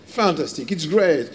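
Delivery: light mock American accent, impressed; lightly imitated American accent. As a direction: gush like the American buyer in his accent. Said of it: "il dit".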